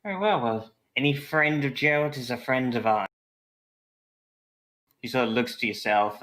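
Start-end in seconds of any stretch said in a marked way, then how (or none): 0.0-3.1 higher barmaid, warming up. Acted. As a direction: welcome a friend of Gerald. Barmaid voiced in scene.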